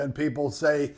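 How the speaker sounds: ordinary talk, nothing marked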